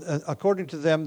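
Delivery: ordinary talk, nothing marked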